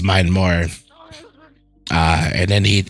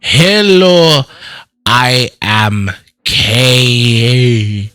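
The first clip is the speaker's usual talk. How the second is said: K attempts a neutral accent, slow and halting; trying a regular accent; 3 semitones higher, 3 dB louder, much slower than usual. Shown as a demonstration. Speaking the practice line, halting regular accent. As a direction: strain to drop the patois, slowly. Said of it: "try to train me into doing a regular accent".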